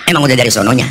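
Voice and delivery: ordinary talk, nothing marked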